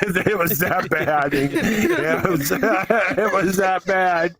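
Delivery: words squeezed out through laughter, slow; laughing while talking. Real, not acted. Telling friends about the slush prank, laughing hard. Laughing recalling brother's scream.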